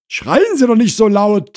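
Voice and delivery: high, loud rebuke; Frau Krause scolding. Acted. Wife to shouting visitor at her door, scolding.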